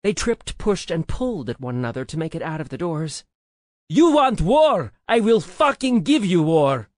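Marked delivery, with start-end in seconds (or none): 3.9-6.9 loud, high roar of threat; furious roar. A narrator's reading. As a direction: roar it at your enemies. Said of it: "Amory roared at us".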